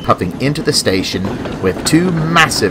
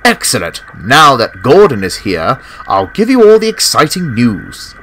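higher, bright engine voice full of news; cheerful Scott; 3 semitones higher than usual. Acted. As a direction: share exciting news as a proud engine.

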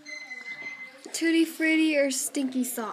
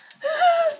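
disgusted gasp; 14 semitones higher, 7 dB louder than usual. Real, not acted. Reacting to gross taste.

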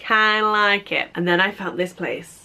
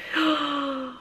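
gasp; 5 semitones higher, 6 dB quieter than usual. Real, not acted. Teapot reveal.

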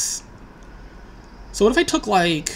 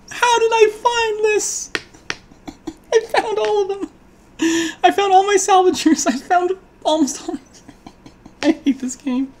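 laughing disbelief; 11 semitones higher than usual. Real, not acted. Disbelief after disaster draw.

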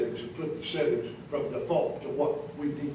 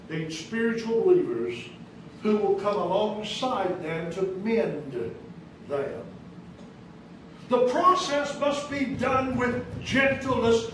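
normal talking voice

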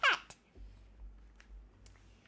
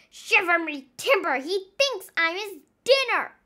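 louder, higher panicked cry; frightened cry; 5 semitones higher, 6 dB louder than usual. Acted. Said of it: "he cried".

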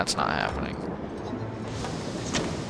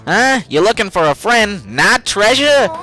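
higher louder Brooklyn voice; Brooklyn accent; 7 semitones higher, 5 dB louder than usual. Acted. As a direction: voice it with Brooklyn flair. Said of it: "Brooklyn accent".

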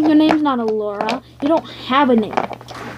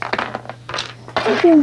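ordinary talk

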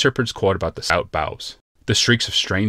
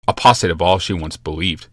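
ordinary delivery

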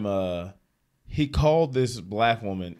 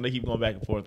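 ordinary delivery

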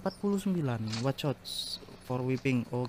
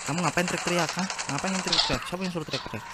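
higher-pitched playful telling-off; mock scolding; 5 semitones higher, faster than usual. Gamer to screaming zombie in mock scolding. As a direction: scold like a fed-up parent, half-serious.

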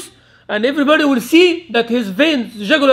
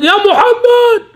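a much higher, louder, slow hail across the rows; rough Bedouin call; 11 semitones higher, 5 dB louder, slower than usual. Retold or imitated. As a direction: call out bluntly from far back in the ranks. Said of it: "like this".

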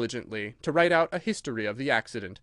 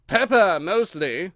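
cook voice; 5 semitones higher, 7 dB louder, slower than usual. Acted.

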